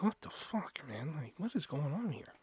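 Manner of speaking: hushed, bewildered mutter to oneself; baffled aside. Retold or imitated. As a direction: re-enact a stunned thought, very softly. Baffled aside while watching them.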